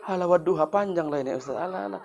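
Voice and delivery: imitating a reluctant worshipper, softly dismissive; quiet mock grumble to the teacher; lazy grumbling. Worshipper balking at a long prayer recitation, lazy grumbling. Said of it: "kita malas, kita pikir".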